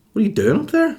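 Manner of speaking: high-pitched mock disbelief; incredulous demand. Husband confronting wife who went upstairs, incredulous.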